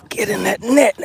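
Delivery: will it into the net; urgent plea. Real, not acted. Angler begging the bass into the net, strained and pleading.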